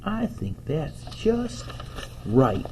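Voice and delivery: put-on high, soft, slow child's voice; smiling contentment. A narrator's reading. Boy happily accepting Mom's compromise, soft pleased wonder. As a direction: let a pleased smile into it, softly. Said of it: "a smile grew on Toby's face".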